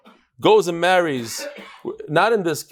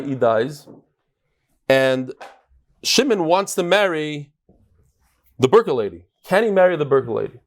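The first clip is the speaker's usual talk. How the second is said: usual speaking voice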